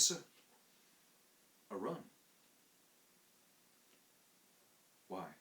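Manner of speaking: a hint of an uneasy Cockney manservant; embarrassed Cockney servant. Thomas admitting to his master that he ran away, uneasy. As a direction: confess it reluctantly.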